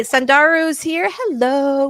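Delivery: high, loud, drawn-out hello; excited greeting. Real, not acted. Host greets a viewer who just arrived, excited. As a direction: sing out a welcome, high and stretched.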